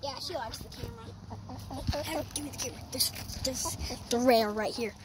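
ordinary talk, nothing marked

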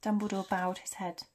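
ordinary delivery

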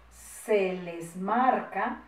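very slow, word by word; drawn-out dictation with rising end. Shown as a demonstration. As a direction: stretch each word for pupils writing.

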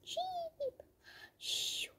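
very high, soft cheep then a whispered shoo; tiny peep, then hush it; hushed chick. Pretend.

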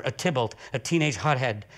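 ordinary talk, nothing marked

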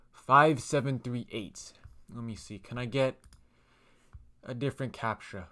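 usual speaking voice

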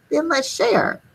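put-on high small boy voice; a hint of a kind little boy; lightly voiced little boy. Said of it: "A little boy said".

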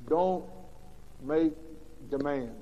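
ordinary talk

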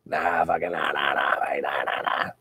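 low put-on Australian nah-nah; mock Australian bloke. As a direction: ham up an Aussie footy fan voice. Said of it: "fucking Australian rules football".

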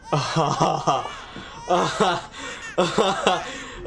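drawn-out bursts of startled laughter; shocked laughter. Real, not acted. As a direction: laugh it out in separate bursts after a scare. Reacting to the scare.

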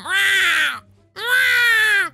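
long, high yowling meow; imitating a cat. Narrator mimics the nightmare cat, drawn-out yowl. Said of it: "kept thinking of that cat going".

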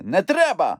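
high, alarmed little cry; frightened plea. A narrator's reading. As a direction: beg them, scared. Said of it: "злякався Хропусь". (злякано)

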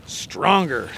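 normal talking voice

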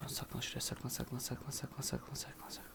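hushed urgent whisper; whispering while hiding. Real, not acted. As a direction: whisper so nobody hears. Hiding from enemy in game.

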